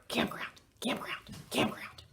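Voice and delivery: whispered into her ear; a conspiratorial repeated whisper. Acted. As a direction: breathe it secretly, no voice. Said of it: "I whisper in Kayla's ear".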